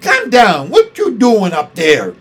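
loud, high scolding constable; angry policeman shouting. A narrator's reading. Policeman shaking his fist, ordering Maya down. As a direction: yell her down from the sky.

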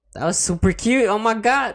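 rising excited gush. Real, not acted. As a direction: squeal over how cute it was. S0 gushes after S1's answer.

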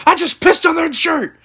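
high-pitched imitation of a coach's yell; retold rallying shout. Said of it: "He said".

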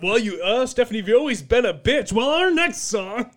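co-host impression. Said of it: "the co-host is like".

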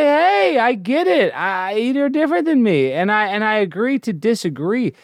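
high-pitched, lilting "no hard feelings" voice; acted easygoing concession, voice lifted high; friendly conceding reply. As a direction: pitch it up high, placating an opponent. Acts out conciliatory reply to opponents.

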